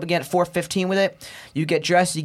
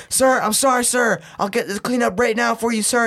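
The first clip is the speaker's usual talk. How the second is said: nervous recruit; 6 semitones higher than usual. Retold or imitated. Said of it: "And I was like".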